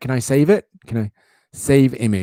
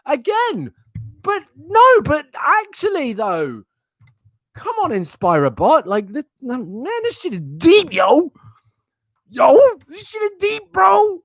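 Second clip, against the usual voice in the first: hyped bro voice; 3 dB louder than usual. Pretend. Mock bro voice at quote.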